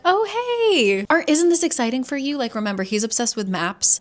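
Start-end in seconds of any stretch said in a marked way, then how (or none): 0.0-2.4 cheery. Retold or imitated. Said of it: "trying to lighten the mood".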